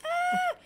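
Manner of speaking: high mock scream; imitated startled scream. Mom stepping on the cat, high mock scream. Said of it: "and she goes".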